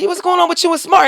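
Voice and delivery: a high put-on caller's voice, fast; curious friend. Joanne voiced calling her.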